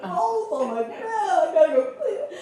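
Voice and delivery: high, teary laugh while retelling a small disaster; laughing through tears. Retold or imitated. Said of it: "then I'm sobbing".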